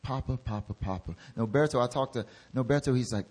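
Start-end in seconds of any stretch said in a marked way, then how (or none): 0.0-1.2 softer, tender calling of Papa; tender prayer. Retold or imitated. Heidi Baker blessing the wedding, tenderly praying. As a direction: murmur it like a child to a father. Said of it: "all she said was".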